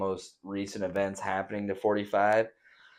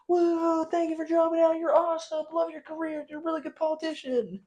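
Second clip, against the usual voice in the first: very high squeaky fawning voice; sugary falsetto thanks; mock gushing praise. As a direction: gush like a cartoon fan. Mocking the party's fake praise.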